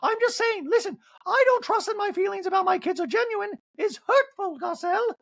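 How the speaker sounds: very high put-on voice, hurt; over-the-top wounded impression way up high; Dorit. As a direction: argue in an affected high pitch. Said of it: "And Dorit's like".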